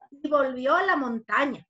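ordinary delivery

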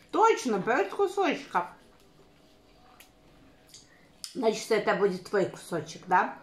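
chewing between words; talking while eating; mouth full of pizza. Real, not acted. Seen 3: woman holds pizza, bites before, cheeks full while speaking.

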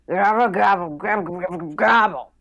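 loud, high turkey gobbling; big burbling turkey call; turkey gobble. Acted. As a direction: gobble wildly at full volume. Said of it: "grab any glass that's around and go".

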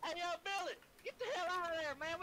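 high yelling call across the map. Real, not acted. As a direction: shout for Billy to get out of there. Calling a friend away.

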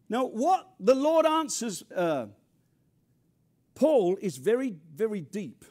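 normal talking voice

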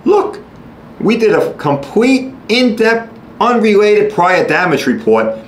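high, slow, pompous adjuster voice; smug insurance adjuster. Retold or imitated. Mocking the insurer rejecting a claim with a pompous report. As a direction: lord the report over the claimant. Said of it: "the insurance company can say".

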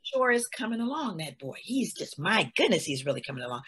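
put-on low, soft, sly praise of the boy; a quiet, menacing slave owner; owner's praise.

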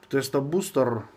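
ordinary talk

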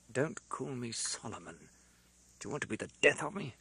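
a man afraid of being found out; hushed, hurried warning; furtive, frightened. A narrator's reading. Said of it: "in a furtive, frightened way".

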